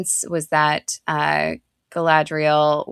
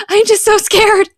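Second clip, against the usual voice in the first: put-on higher, louder wailing child; higher, louder, near-crying little girl; more scared daughter; 8 dB louder than usual. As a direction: let the child's fear spill over. Daughter voiced, panicking.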